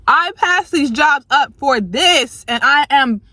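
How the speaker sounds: slow, high, wounded complaint; aggrieved outburst. Real, not acted. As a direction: lay out the injustice, voice climbing. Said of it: "I'm just pissed".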